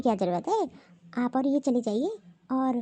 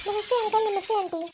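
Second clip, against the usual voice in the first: put-on higher, timid greeting from the young wife; meek daughter-in-law; 6 semitones higher than usual. Daughter-in-law greets the visiting guests, higher and timid. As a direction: greet shyly, voice pitched up.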